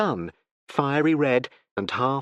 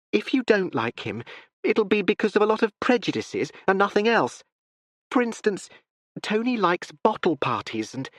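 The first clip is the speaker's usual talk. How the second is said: lightly higher, hurried explaining; eager daughter; 8 semitones higher than usual. A narrator's reading. Daughter preparing her father, eager. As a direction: coax him gently toward meeting. Constance defends Tony.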